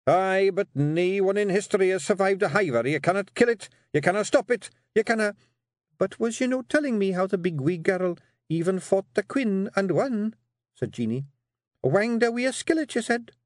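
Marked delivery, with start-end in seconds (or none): 0.1-5.4 high, urgent warning; alarmed Scottish clansman. Acted. Rob warns his wife about the hiver, alarmed. 6.0-10.4 high, pointed woman's question; challenging Scottish Kelda. Acted. Jeanie reminds Rob of the girl's victory, pointedly.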